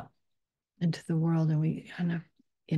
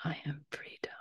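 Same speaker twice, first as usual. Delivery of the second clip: much softer, barely a whisper; faint whisper; 14 dB quieter than usual. Acted. Guide whispering freedom to the listeners, faint whisper. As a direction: let freedom vanish into breath.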